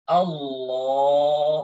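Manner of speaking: "Allah" held for a long time; overlong Allah. Shown as a demonstration. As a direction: drag out "Allah" as a wrong example. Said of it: "lama banget". ("Allah" dibaca sangat panjang)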